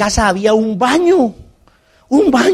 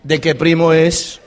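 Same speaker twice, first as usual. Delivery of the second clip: teasing onlookers; 3 semitones lower, slower than usual. Retold or imitated. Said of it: "todo el mundo decía".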